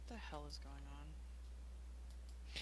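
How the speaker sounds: hushed, slow whispered question. Acted. Amanet whispers through the orb to the others, hushed whisper. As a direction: whisper it slowly, keeping very quiet. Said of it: "Amanet's gonna whisper".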